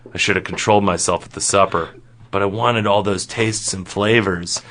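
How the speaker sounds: quick, low-key admission; subdued regret. Acted. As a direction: own up in a low, understated voice. Retake of the line after interruption.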